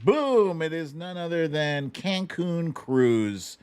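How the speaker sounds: ordinary talk, nothing marked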